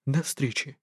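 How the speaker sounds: a soft, quiet goodbye. A narrator's reading. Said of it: "тихо сказал профессор". (тихо, негромко)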